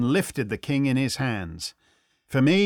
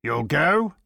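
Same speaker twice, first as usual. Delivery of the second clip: urgent, rising question; crying out. A narrator's reading. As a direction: press eagerly for an answer.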